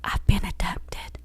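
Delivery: hushed, breathless whisper. Retold or imitated. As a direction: whisper it like a captive on the phone. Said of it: "she had to whisper".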